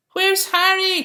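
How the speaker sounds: high, loud calling out; booming search call. A narrator's reading. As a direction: call across the beach for Harry. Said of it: "called the man".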